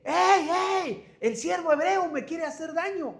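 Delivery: high, loud accusing cry for help; woman screaming. Retold or imitated. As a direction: cry out in alarm, pointing at him. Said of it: "la mujer empieza a gritar, y grita". (grito agudo y fuerte de alarma, acusando)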